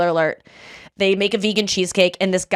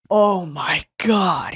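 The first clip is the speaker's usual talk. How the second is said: amazed; much slower than usual. Retold or imitated. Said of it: "he was like".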